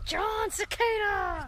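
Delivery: loud slow playful shout; shouted silly name. Pretend. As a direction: belt the name out slowly. Child chants joke name.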